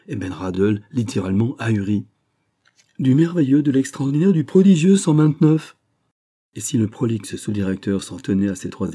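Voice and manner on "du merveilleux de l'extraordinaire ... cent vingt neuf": excited praise. A narrator's reading. Enthusiastic sub-director praising claim.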